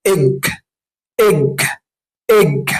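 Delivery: إج said as a short, clipped e sound; short e drilled. Shown as a demonstration. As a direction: repeat إج slowly like a phonics drill. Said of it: "يبقى بيضة معناها إيه؟".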